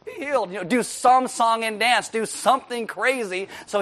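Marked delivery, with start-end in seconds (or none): 0.0-0.5 high-pitched mock healing command; hammed-up wonder-working prophet voice; showy healer. Prophet putting on a show for Naaman, theatrical.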